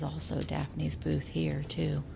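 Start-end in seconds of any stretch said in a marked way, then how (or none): none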